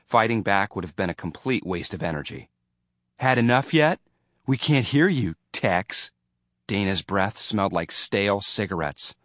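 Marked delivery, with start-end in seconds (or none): 3.2-6.1 raised, needling jeer; bully's taunt. A narrator's reading. Dana taunting the new kid he has pinned, mocking jeer. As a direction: goad him with a mocking challenge.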